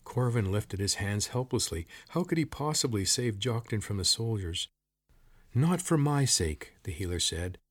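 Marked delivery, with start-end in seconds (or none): 5.5-6.7 higher, slow, insistent plea; urgent plea. A narrator's reading. Old woman urging a rescue of her husband, insistent. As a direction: press the point earnestly.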